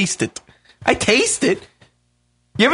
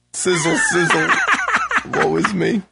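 much higher mock lament, laughing; dramatic sigh; 13 semitones higher than usual. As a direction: wail it high through laughs.

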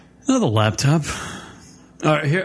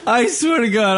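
slow laughing words; 14 semitones higher, slower than usual. Real, not acted. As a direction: laugh helplessly at the mishap. Computer just died on air.

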